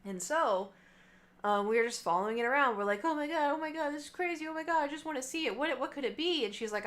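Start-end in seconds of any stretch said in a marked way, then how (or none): 3.0-6.5 higher, rushed and giddy; excited. Retold or imitated. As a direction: gush with disbelief as the fish runs. Retelling the chase of the fish.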